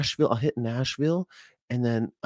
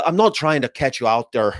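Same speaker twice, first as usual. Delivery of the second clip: put-on Irish accent, playful; 4 dB louder than usual. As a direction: quote him in a mock Irish brogue. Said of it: "spread some love about Cliff Grant".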